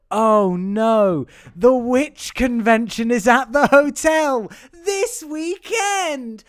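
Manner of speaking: slow, high-pitched feigned dismay; exaggerated storybook alarm, played as a joke; mock-dramatic bit. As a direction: draw it out high, hamming up the shock. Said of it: "I was just doing a bit".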